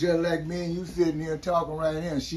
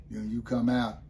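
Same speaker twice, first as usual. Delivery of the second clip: ordinary talk, nothing marked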